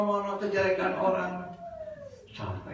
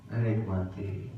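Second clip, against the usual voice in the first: menikmati drawn out slowly and lower; savoring; 8 semitones lower, 3 dB quieter than usual. Shown as a demonstration. Preacher showing congregation how to savor dhikr, slow and soft. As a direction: linger over menikmati softly.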